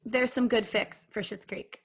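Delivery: usual speaking voice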